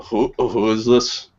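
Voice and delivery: fake drunk. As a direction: play a badly faked drunk picking up. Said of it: "I started pretending that I was drunk".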